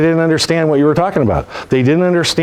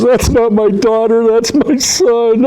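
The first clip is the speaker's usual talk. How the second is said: offended father; 9 semitones higher, much slower than usual. Retold or imitated. Father correcting the salesman about his son, slow and hurt. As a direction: correct the compliment, wounded and slow. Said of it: "The guy goes".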